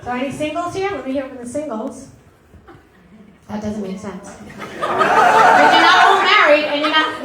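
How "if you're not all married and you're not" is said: loud, drawn-out call to the crowd; loud slow call to the audience. Real, not acted. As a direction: stretch it out loud to the back row. Calling out to audience.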